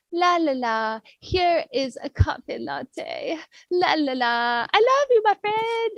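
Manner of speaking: sing-song sweet friend. Pretend. Friend B sings to you with a latte, sing-song and sweet. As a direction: hum it like a sugary friend bringing coffee.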